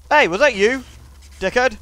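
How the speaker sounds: loud, high annoyed accusation; indignant challenge. Real, not acted. Player confronting whatever just hit him, indignant and loud. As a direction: confront the culprit loudly.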